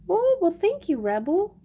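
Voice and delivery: high, loud delighted oh; surprised and touched. Real, not acted. Touched by a viewer comment.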